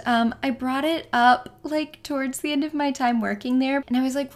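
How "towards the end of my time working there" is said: ordinary talk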